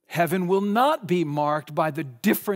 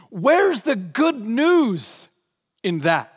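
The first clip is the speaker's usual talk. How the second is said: loud, slow and higher, pressing each word; emphatic challenge; 3 semitones higher, 4 dB louder, slower than usual. Real, not acted. Preacher to congregation, a loud slow challenge. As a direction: demand it of the room.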